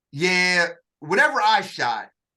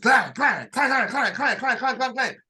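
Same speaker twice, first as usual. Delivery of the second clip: rapid higher clang sounds; imitated target clangs; 6 semitones higher, 3 dB louder than usual. Recruit reliving hitting every target, clanging. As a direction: ring them off like steel targets.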